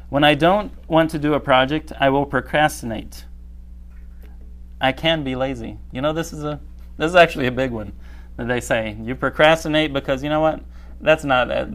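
normal talking voice

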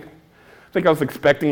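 usual speaking voice

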